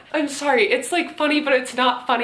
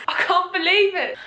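mock disbelief; 11 semitones higher, 3 dB louder than usual. Mock shocked reaction.

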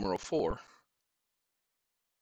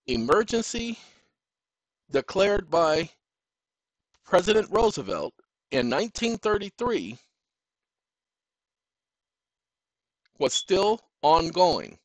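emphatic; 7 semitones higher, 5 dB louder than usual. Real, not acted. Building emphasis on a legal point.

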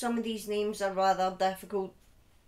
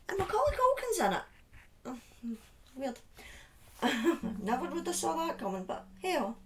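high, fast amused aside; mock surprise; 6 semitones higher than usual. Real, not acted. Host telling chat about the show, amused surprise. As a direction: feign being amazed, then laugh.

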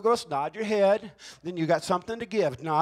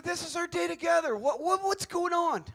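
higher, slowing on the question; pleading to wife; 8 semitones higher than usual. Retold or imitated. Husband asking his wife what is wrong, pleading. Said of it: "I said".

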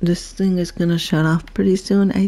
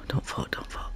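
breathless hushed worry; anxious whisper; 16 dB quieter than usual. Real, not acted. Watching a creature teeter, anxious whisper. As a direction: whisper it willing them not to fall.

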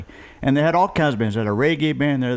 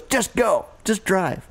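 high, urgent panicked push to just go; a father spooked by a knock, urging the driver on; panicky dad; 7 semitones higher, slower than usual. Retold or imitated. Father urging the driver, in a high panicked voice. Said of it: "He goes".

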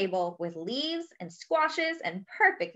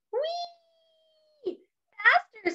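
very high-pitched gleeful squeal; much slower than usual. Acted. Duck riding in the shopping basket, squealing. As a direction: squeal like you're zooming along on a ride. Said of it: "squealed Duck".

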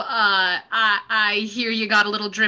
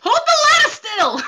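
much higher, louder shout down the ladder; panicked yell; 5 dB louder than usual. Acted. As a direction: yell at your brother to steady it. Mario yelling from wobbling ladder.

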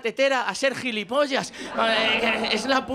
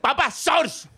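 insistent daughter correcting; much slower than usual. Acted. Daughter insistently correcting father's word.

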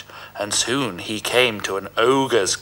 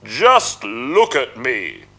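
slow, louder scornful ogre voice; sneering ogre; 4 dB louder, slower than usual. Acted. Ogre scoffing at Puss, slow sneer. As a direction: sneer down at the cat. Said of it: "he sneered".

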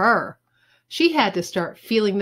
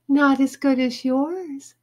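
put-on high girlish coo; 6 semitones higher than usual. A narrator's reading. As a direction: flatter him with a sweet coo. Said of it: "she cooed".

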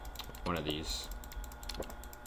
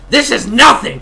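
high, loud mock-aggressive shout; aggressive outburst; 12 semitones higher, 14 dB louder than usual. Gamer telling off the viewers, aggressive shout. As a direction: burst out loudly, half-joking aggression. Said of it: "I'm so aggressive towards you".

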